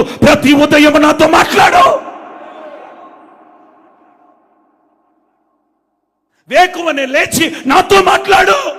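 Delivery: high, slow shouted appeal; impassioned divine plea. Acted. Preacher voicing God's wish to his people, shouted appeal. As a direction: cry it out, stretching the words. God's demand voiced at full force.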